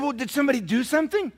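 a father pressing anxiously for answers; frantic question. Retold or imitated. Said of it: "I said".

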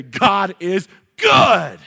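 loud, drawn-out hammed-up praise; exaggerated hallelujah-style holler to a neighbor; mock pious shout. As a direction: stretch each word and belt out the last. Hypocrite's greeting to the neighbor.

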